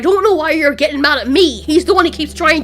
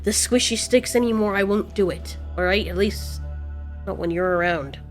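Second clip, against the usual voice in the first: low, very soft, halting promise; tender apology; 5 semitones lower, 6 dB quieter than usual. Acted. Range promising mate not to kill, tender and low. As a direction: make peace quietly, voice sunk low.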